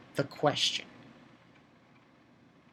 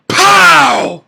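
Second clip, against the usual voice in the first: loud, higher "pow" like a blow landing; loud impact sound; 8 semitones higher, 13 dB louder than usual. Retold or imitated. Reviewer voicing the blow that sends Curdle flying, loud burst. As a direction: punch out the hit sound.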